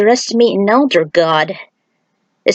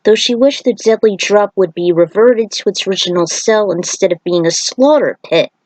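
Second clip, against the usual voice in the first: usual speaking voice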